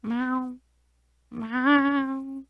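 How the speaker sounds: soft mock meows; cat meow. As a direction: meow softly as the cat answering the judge. Wife voices the cat testifying.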